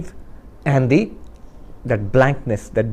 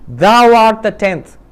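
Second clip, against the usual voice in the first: slow, louder pronouncement; solemn wise man; 6 semitones higher, 5 dB louder, slower than usual. Acted. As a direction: declare it like a sacred truth. Wise man repeats the revelation.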